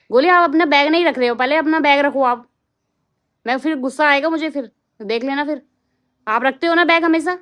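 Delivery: firm mom telling her son off; stern scolding. Real, not acted. Mother scolding son about bag.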